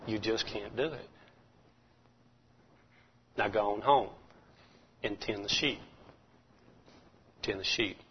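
low, hushed, dismissive taunt; discouraging hush. Acted. Preacher voicing the doubter telling David to go home, hushed and low. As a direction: drop to a near-whisper saying you can't.

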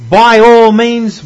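slow it down and hit "all"; emphasized. Shown as a demonstration. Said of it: "I want to emphasize this here".

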